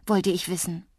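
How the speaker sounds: ordinary delivery, nothing marked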